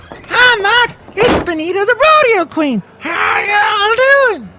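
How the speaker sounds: hollering hello like a rodeo queen; hammed-up cowgirl whoop. Cohost enters as rodeo queen persona.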